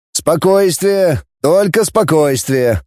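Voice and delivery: deliberate call for calm; calming order. Acted. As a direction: stretch it out to settle a crowd. Commander holds back angry crowd.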